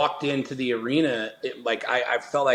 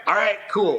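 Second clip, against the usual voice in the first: high, loud "all right, cool"; breezy agreement; 8 semitones higher, 4 dB louder than usual. Retold or imitated. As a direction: toss it off brightly, mock-casual. Giving in to God's answer.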